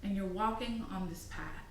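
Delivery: soft guided-meditation narration, phrase by phrase; guided visualization. Acted. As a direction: guide the listener gently along an imagined path.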